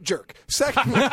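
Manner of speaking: ordinary talk, nothing marked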